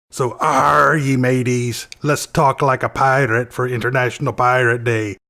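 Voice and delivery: lightly gruff Irish pirate brogue; Irish pirate accent. Pretend. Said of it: "That was me Irish pirate".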